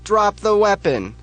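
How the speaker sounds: high, loud, slow police order; police shout. Acted. Police yell at Big Bob, loud shouted command. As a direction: order him to freeze at gunpoint.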